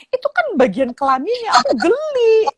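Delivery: high imitated squeal of disgust; grossed-out child. Child complaining to mother about a classmate, high disgusted voice. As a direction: whine it like a squeamish kid.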